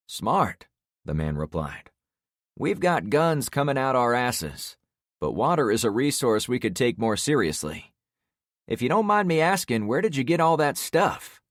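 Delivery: lightly voiced neighbor; neighbor man voice.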